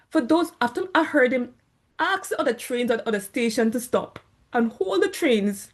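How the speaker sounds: ordinary delivery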